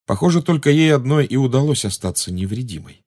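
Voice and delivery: plain reading voice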